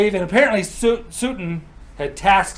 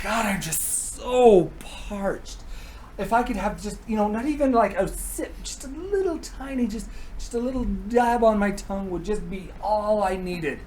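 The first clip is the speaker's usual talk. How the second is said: high, soft wheedling whine; pleading, hammed-up; 5 semitones higher, 4 dB quieter than usual. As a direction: beg daintily, dragging out the thirst. Odin begging ever harder for mead.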